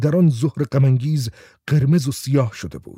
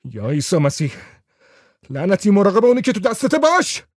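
swear, then shout the warning; 7 semitones higher than usual. A narrator's reading. Raco yelling at the man to mind the lighter, building shout. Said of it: "راکو فریاد زد".

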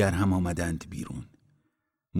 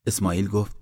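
ordinary delivery, nothing marked

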